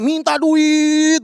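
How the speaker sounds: put-on a kid at the ATM whining for money; whiny child. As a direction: beg like a spoiled kid. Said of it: "Ada yang mencet-mencet A T M".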